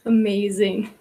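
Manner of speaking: usual speaking voice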